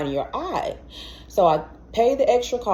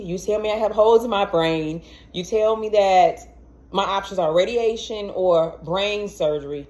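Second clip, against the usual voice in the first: ordinary delivery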